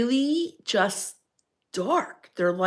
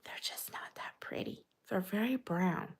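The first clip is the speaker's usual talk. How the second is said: hushed whisper; confiding whisper about ugly birds; whisper aside; 13 dB quieter than usual. Real, not acted. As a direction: let them in on a secret.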